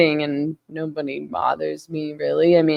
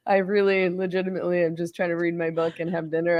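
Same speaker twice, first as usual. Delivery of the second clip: ordinary delivery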